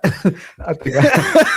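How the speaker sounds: words dissolving into loud, high laughter; breaking up laughing. Real, not acted. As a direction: try to say it through laughter, slowing right down. Cracks up telling his death.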